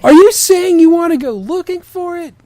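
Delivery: high, loud hammed-up demand; over-the-top demanding shout; mock demanding shout. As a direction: bellow the question in outrage. Demanded, acted fic dialogue.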